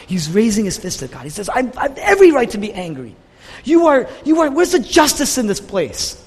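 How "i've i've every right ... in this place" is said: raised, higher voice protesting to God; indignant outcry. Acted. Jonah shaking his fist at God, an indignant raised outcry. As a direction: cry out that it isn't fair. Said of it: "He's yelling at God".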